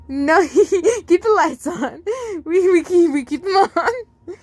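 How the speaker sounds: put-on high, giggly child voice, laughing through the words; a tickled kid squealing between words; child laughing while tickled; giggling child.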